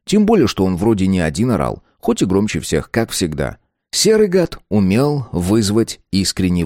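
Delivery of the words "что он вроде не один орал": plain reading voice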